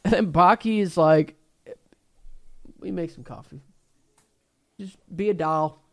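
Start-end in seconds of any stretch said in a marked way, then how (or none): 2.8-5.8 soft, sugary mocking request; mock sweet coaxing. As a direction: coax Dad like a good boy, softly. Baki mocking his father sweetly.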